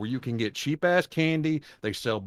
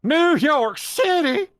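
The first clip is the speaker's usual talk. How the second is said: much higher, slow stretched-out mock; mock drawl; 6 dB louder, much slower than usual. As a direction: drag each word out up high. Mocking S2's New York line.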